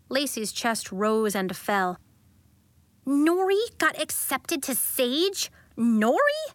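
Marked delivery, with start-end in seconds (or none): 3.0-6.4 scornful disbelief. A narrator's reading. Lacey in disbelief.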